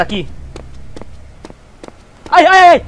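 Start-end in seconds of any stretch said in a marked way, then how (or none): 2.3-2.8 startled scream. Real, not acted. Jump scare in horror game.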